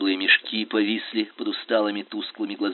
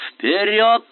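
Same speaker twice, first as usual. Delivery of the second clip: loud rallying cry; shout; 11 semitones higher, 7 dB louder than usual. A narrator's reading. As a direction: shout them forward, high and loud. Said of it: "крикнул Туталмин". (громкий боевой крик)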